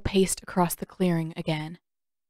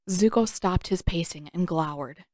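usual speaking voice

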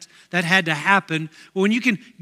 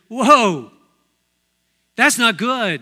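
louder startled "whoa"; acted alarm; 3 semitones higher, 5 dB louder, slower than usual. Doctor friend hearing the heart through his stethoscope, acted alarm. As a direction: react like a doctor taken aback. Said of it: "his eyes get this big".

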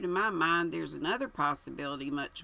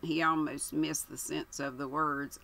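ordinary delivery, nothing marked

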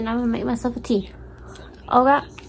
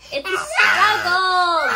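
laughing wail; 8 semitones higher, 7 dB louder, much slower than usual. Real, not acted. Mom venting about school struggle.

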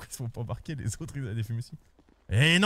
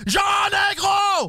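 very slow, higher, loud shout; slow drawn-out shout; 10 semitones higher, 4 dB louder, much slower than usual. Acted. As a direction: stretch out the yell, fuming. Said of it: "faut pas gueuler comme ça".

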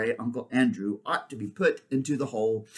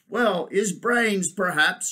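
slow, high elephant voice; mild reply; 7 semitones higher, 5 dB louder, slower than usual. Acted. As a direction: suggest it mildly, drawing it out. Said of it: "she replied mildly".

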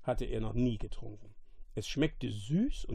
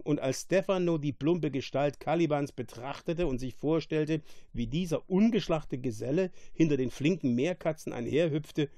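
usual speaking voice

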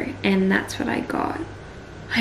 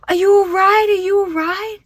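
imitating worried passengers, pitched much higher; concerned bystanders; 11 semitones higher, 4 dB louder than usual. Passengers checking on the woman who vomited, high concerned voice. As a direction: ask it high and anxious, like a stranger checking on her. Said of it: "And everyone was like".

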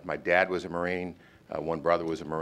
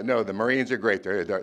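normal talking voice

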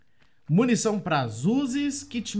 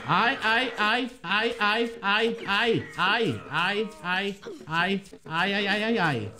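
rapid repeated cries of alarm; panicked yelping; much slower than usual. Real, not acted. Gamer attacked by a pack, yelping in alarm. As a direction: yelp over and over in panic.

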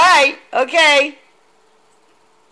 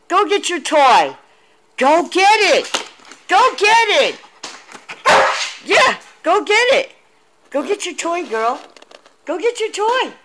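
high, eager sing-song urging; coaxing dog talk; 5 semitones higher than usual. Real, not acted. Owner urging the puppy to fetch, high and coaxing.

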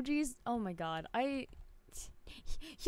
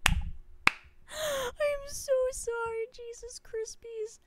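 high, slow apology through laughter; laughing apology to the viewer she mixed up; 13 semitones higher, 4 dB louder, much slower than usual. Real, not acted. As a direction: squeal sorry while laughing.

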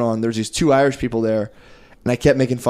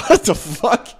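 high, laughing what the fuck; laughing disbelief; 9 semitones higher, slower than usual. Retold or imitated. Him reacting to the lady, laughing high disbelief. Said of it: "I was like".

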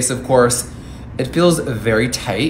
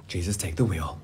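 gasping exclaim; 3 semitones lower, 4 dB louder than usual. Real, not acted. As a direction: gasp at the scary color. Gasp at red blush.

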